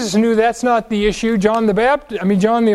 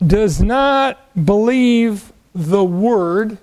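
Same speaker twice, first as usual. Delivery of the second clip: "does not believe the Word" very slow, each word separated; weighty, drawn-out "not" and "the Word"; slow emphasis; much slower than usual. Real, not acted. Drives home point from verse twelve.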